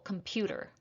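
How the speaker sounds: computer stressed on PU, said softly; stress on second syllable. Shown as a demonstration. Teacher giving the answer, computer stressed on PU. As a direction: softly say computer as com-PU-ter. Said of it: "it's the second syllable".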